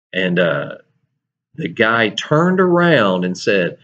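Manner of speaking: ordinary talk, nothing marked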